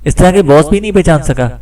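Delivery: usual speaking voice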